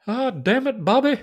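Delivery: exasperated Texan drawl; a light Hank Hill voice; Hank Hill impression.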